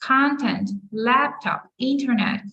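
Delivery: "content", "laptop", "internet" stressed on CON, LAP, IN; stress on first syllable. Shown as a demonstration. Teacher lists example words, first-syllable stress. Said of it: "ударяємо наголос на перший склад".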